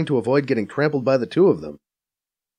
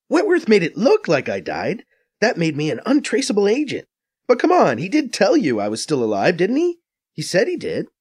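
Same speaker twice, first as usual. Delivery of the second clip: higher, brisk Faiza voice, a bit scornful; Faiza explaining; 7 semitones higher than usual. A narrator's reading. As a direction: narrator playing Faiza, quick and knowing.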